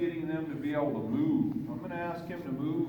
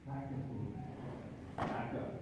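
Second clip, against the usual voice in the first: soft cue; 6 semitones lower, 8 dB quieter than usual. Real, not acted. Calm cue to horse.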